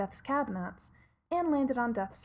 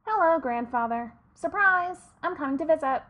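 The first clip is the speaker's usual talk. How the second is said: cheerful; 6 semitones higher, 7 dB louder than usual. Acted. Raven relays cheerful message.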